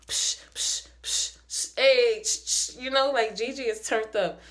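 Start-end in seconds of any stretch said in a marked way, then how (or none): 0.1-2.8 breathy psh psh, cocky hype noises; hyped psh sounds. Pretend. Mimicking Gigi turned up.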